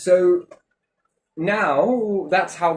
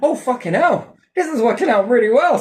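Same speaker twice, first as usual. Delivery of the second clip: higher, gleeful outburst; inner glee; 10 semitones higher, 6 dB louder than usual. Retold or imitated. His inner voice gloating as they buy it, gleeful. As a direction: crow with secret delight. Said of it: "another part of me is like".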